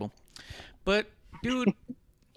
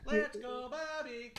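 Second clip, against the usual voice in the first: high, softer half-hearted cheer; mock half-hearted cheer; 7 semitones higher, 11 dB quieter, slower than usual. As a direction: mumble a limp cheer, pitched up. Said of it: "He literally just goes".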